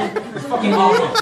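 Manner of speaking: high giggling laugh; laughing at own joke; laughing through words. Real, not acted.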